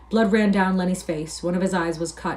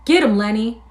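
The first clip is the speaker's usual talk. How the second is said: loud, raised call to attack; yelling; 4 semitones higher, 7 dB louder than usual. A narrator's reading. George urging Lenny to fight, yelling. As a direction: yell it across the room. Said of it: "cupped his hand round his mouth and yelled".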